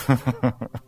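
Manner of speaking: quick soft chuckle; a little laugh at his own point; brief amused chuckle; short laugh. Real, not acted.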